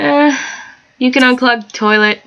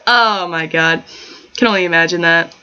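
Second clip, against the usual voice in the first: ordinary delivery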